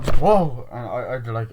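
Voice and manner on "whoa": loud, raised mock startled whoa. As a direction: imitate a jolt of surprise. Retold startled reaction.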